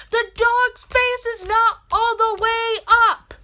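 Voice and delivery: high, loud mock yell, drawn out; indignant retort. Daughter arguing with mom about the dog, indignant high yell. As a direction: yell it back in exasperated disbelief. Retelling heated argument with mom.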